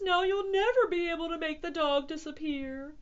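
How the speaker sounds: high, whining alarm; dismayed cry. A narrator's reading. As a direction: wail in despair. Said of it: "cried the cats".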